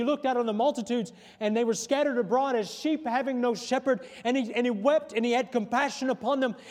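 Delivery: full-voiced sermon delivery; projected, rolling pulpit cadence; preaching. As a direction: preach it out with driving emphasis.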